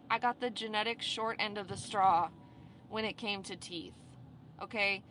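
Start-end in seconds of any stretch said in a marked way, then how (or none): none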